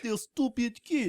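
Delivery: slow, lightly put-on Russian accent; villain mocking the kid, slow in a Russian accent. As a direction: drawl it out like a movie Russian villain. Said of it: "That's much better Russian accent".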